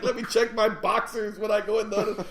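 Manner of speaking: high, amused comic worry; laughing joke. Retold or imitated. Passenger joking after a scary ride, laughing mock worry.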